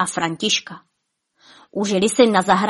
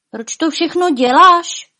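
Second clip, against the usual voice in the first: higher, louder, drawn-out wondering question; puzzled wonder; 9 semitones higher, 7 dB louder than usual. A narrator's reading. Špuntík questions Bonifác about his odd doings, puzzled wonder. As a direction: ask it in wonder, voice lifted. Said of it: "divil se Špuntík".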